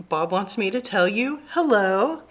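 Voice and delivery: plain reading voice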